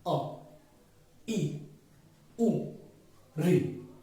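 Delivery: अ ई उ री each said short and clipped apart; short vowels spoken one at a time; short vowels demo. Shown as a demonstration. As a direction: sound each short vowel quickly with a pause after it. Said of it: "देखिए, बोल के देखिए".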